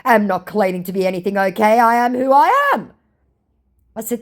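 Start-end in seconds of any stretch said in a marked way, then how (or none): 0.0-2.9 louder, higher huffy protest; mock indignant. Scammer snaps back at her, mock indignant and louder. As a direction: bristle with fake offence. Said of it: "To which his response was".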